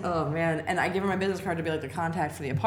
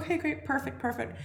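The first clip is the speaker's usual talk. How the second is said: high, put-on voice of the landlady; 10 semitones higher than usual. Landlady signing the lease, in a high put-on voice. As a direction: chirp it like the eager landlady.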